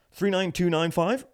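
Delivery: high, slow digits with rising end; querying clerk. Retold or imitated. Clerk reading card numbers to customer, mock querying.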